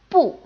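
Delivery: "bu" falling sharply from high; fourth tone bu. Shown as a demonstration. Teacher introduces the word, "bu" falling. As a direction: drop "bu" short and firm. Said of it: "normally takes the fourth tone".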